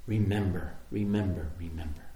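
low, softening repetition; gentle echo dying away; fading reminder. Acted. Hushed repeated reminder.

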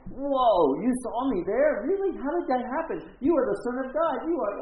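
high, loud, wide-eyed awe; awed amazement. Retold or imitated. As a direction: gasp it out in astonished praise. Nathanael amazed by Jesus.

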